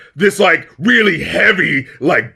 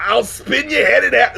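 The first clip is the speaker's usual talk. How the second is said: mock [name] yell; 6 semitones higher than usual. Mocking [name] lyric delivery.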